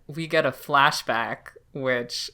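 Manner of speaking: ordinary talk, nothing marked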